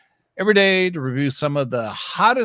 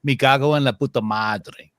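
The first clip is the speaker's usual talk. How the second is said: Spanish phrase in a Spain accent; Castilian Spanish accent; 5 dB louder than usual. Shown as a demonstration. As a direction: say it like they do in Spain. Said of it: "Here's a phrase that they say in Spanish, uh, in Spain".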